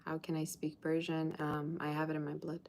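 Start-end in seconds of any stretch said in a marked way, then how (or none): none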